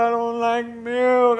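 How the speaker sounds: high, very slow whine; whining wife impression. Wife refusing cornflakes in milk, mock whining.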